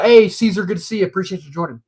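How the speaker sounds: usual speaking voice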